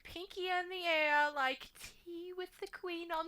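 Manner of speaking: high, hammed-up posh British accent; mock posh British accent. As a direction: put on a pinky-out, tea-with-the-Queen British voice. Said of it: "British accents is that whole".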